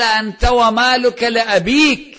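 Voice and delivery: loud, high, slow proclamation; emphatic verdict. Retold or imitated. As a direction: deliver the ruling forcefully, drawing it out. Quoting the Prophet's verdict.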